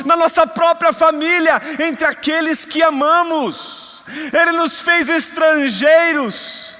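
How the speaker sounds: high, loud, slow preaching cry; preaching at full force. Acted. Preacher to his congregation about being strangers, full-force preaching. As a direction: proclaim it to the whole church, slowly and loud.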